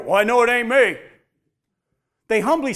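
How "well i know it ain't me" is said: loud, cocky brush-off; smug denial. Acted. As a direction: toss it off, full of yourself. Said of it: "They didn't sit there and go".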